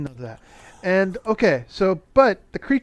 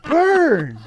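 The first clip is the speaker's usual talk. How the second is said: raised, louder whoop, last word highest; gleeful burn reaction; 5 semitones higher, 5 dB louder, much slower than usual. Retold or imitated. Reviewer reacting to the creature's snub, loud crowing whoop. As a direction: crow over a savage put-down. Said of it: "And I'm like".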